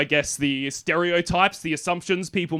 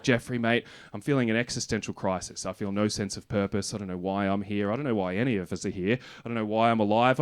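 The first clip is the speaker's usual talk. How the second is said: retold despondency; 6 semitones lower, 5 dB quieter than usual. Confides existential crisis to therapist.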